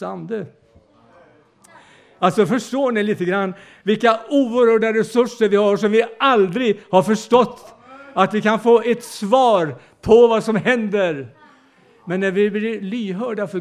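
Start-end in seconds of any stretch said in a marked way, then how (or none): 2.2-11.3 preaching. Acted. Preacher building to climax.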